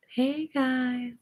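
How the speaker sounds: ordinary delivery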